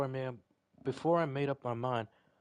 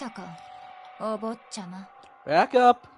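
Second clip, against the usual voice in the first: shouting; 9 semitones higher, 14 dB louder, slower than usual. Real, not acted. Reacting to the game.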